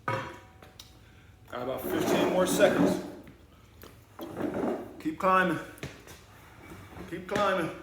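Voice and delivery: loud coaching. Acted. Coach urges kids climbing.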